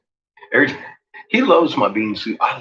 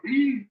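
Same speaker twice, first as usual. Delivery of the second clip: high squeak; 13 semitones higher, 5 dB quieter than usual. Retold or imitated. Farmer's tense squeak.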